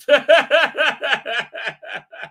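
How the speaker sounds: high, loud run of laughter; real laughing. Real, not acted. Streamer reacting to a joke about the show, laughing. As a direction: burst out in a long, pulsing laugh. Amused by a viewer's comment.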